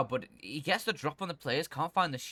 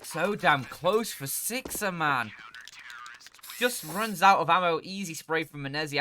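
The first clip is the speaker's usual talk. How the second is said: excited caster; 5 semitones higher, 5 dB louder, slower than usual. Real, not acted. Co-caster reacts loudly.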